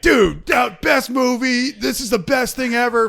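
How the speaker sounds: high-pitched, drawn-out gushing; hammed-up raving fanboy shout; mock over-excitement. Co-host imitates his hyped friend, mock-ecstatic. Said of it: "you're just really excited".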